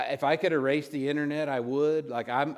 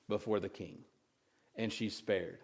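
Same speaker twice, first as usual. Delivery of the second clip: both lines dropped much softer and lower, almost under the breath; hushed aside; 5 semitones lower, 9 dB quieter than usual. Real, not acted. To the congregation, hushed before the big repeat, spoken softly. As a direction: let it fall away softly, like a secret.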